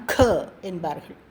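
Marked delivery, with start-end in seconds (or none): 0.1-0.2 breathy kha with a strong puff on க; க as a heavily aspirated "kha". Shown as a demonstration. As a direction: push extra air out on க. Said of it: "இதை மகாப்ரான்".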